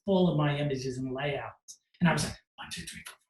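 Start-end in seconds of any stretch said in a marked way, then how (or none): 2.6-3.1 counting under the breath; whispered counting. Retold or imitated. Staring at the wall of photos, counting in a whisper. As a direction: count softly to yourself.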